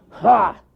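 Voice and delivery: loud high scoffing ha; mocking bear ha; scornful ha. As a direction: sneer a single ha.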